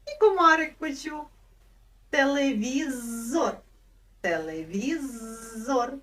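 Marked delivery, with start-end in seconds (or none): 2.1-5.9 Телевізор slow, з held long; stretched z. Shown as a demonstration. Teacher stretching Телевізор so children catch its з. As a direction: hold the з in Телевізор, slowly. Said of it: "Почули".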